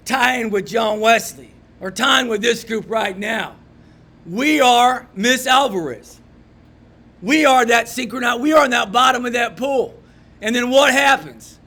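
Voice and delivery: rally the room with rising force; fiery preaching. Acted.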